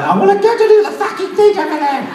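high, fast squeal of a voice; high-pitched mocking imitation; mimicked audience woman. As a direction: rattle it off high in a mock woman's voice. Mimics woman on stage excitedly.

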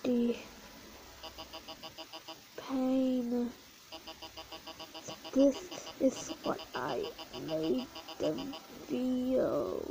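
low, soft and slowly drawn out; acted agony. Suffering character regretting harm done, slow pained murmur. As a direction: draw out each word in pain.

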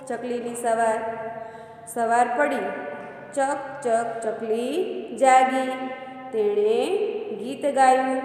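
slow, gentle storybook reading; unhurried children's story voice. A narrator's reading. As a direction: read it slowly like a bedtime tale for kids. Teacher reads children's story aloud.

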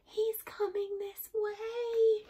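high, slow, drawn-out fearful voice; frightened stage whisper. A narrator's reading. Boy watches the bear approach, in a fearful hush. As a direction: squeak it, dreading his approach. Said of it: "whispered Eddie".

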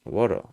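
"water" echoed with a flapped T; rolled American T. Shown as a demonstration. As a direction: soften the T in "water" into a tap. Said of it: "미국에서는 이 T 발음을 혀로 굴려가지고". ("water"의 T를 미국식으로 굴려서)

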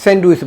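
স্যান্ডউইচ as "send-uis", d sounded; common mispronunciation. Shown as a demonstration. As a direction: keep the d in স্যান্ডউইচ. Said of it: "কীভাবে উচ্চারণ করে থাকি".

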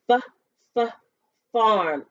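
F then f then farm, sound by sound. Shown as a demonstration. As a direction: break farm into its first sound.

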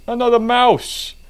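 startled cry at a mouse; sudden yelp at a mouse; surprised yelp. Real, not acted.

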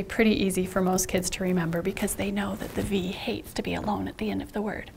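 unvoiced aside; whispered aside. Real, not acted. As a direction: whisper it like a secret. Teacher aside to viewers.

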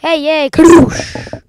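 loud, slow, stretched Kadoosh; drawn-out catchphrase yell. Real, not acted. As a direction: savour the catchphrase. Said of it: "It's my thing".

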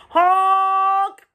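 high, loud, sustained honk; yelled honk. A narrator's reading. Dump truck demanding the road, yelled honk. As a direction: blare it like a truck horn. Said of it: "yelled a dump truck".

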